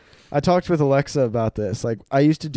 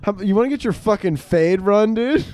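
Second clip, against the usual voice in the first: mock tough threat; 7 semitones higher than usual. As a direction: pick a fight, half joking. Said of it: "And I was like".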